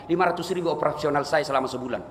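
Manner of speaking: normal talking voice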